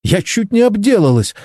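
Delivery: high-pitched indignation without raising the volume; indignant reproach. A narrator's reading. Chen rebukes her partner for scaring her, indignant. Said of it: "возмутилась Чен с укором".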